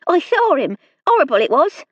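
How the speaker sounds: a frightened country maid pleading her case; nervous serving girl. A narrator's reading. Hilda voice held.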